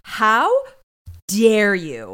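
loud, drawn-out indignation; outraged. Retold or imitated. Reacting to Jennifer withholding his name, loud and outraged. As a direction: punch it out slowly with mock outrage.

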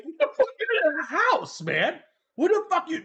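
high, loud retold outcry; alarmed disbelief. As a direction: re-enact a shocked outburst, half laughing. Retells alarmed reaction to friend's call.

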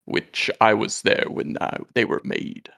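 Aslan. Acted.

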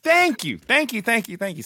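overjoyed vindication, winding down; excited thanks. Real, not acted. Someone finally agrees: soda.

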